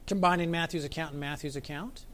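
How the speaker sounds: normal talking voice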